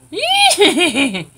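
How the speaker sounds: loud, high burst of laughter at himself; a man laughing at his teeth on camera; self-mocking laugh. Real, not acted. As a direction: laugh out at his own reflection. Laughs at own horse teeth.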